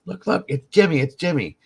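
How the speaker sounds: imitating an urgent, high-pitched alert; mock excited pointing-out, loud and high. Brandy spots the brother in Walmart, excited.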